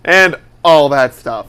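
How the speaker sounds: slow, loud air-quote emphasis. Shown as a demonstration. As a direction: drag it out with ironic air quotes. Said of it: "Air quotes".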